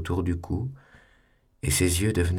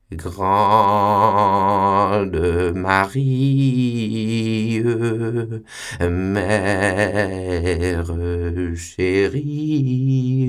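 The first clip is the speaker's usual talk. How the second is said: loud, drawn-out sneering recital of the poem; mocking recital; 2 semitones higher, 5 dB louder, much slower than usual. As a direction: ham up the verse with contempt. Superior reads the poem.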